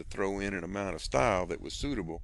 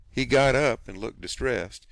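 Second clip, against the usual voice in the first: ordinary delivery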